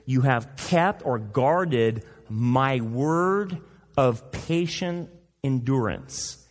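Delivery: drawn-out, careful reading; measured scripture reading; slow deliberate translation. Shown as a demonstration. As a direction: lay out each word slowly. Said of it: "should be translated in the Greek is".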